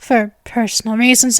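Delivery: ordinary talk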